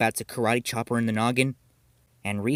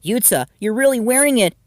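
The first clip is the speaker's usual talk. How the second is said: higher, louder, thrilled discovery; light up as you catch him out; gleeful, delighted gotcha; excited; 11 semitones higher, 9 dB louder than usual. Acted. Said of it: "she said excitedly".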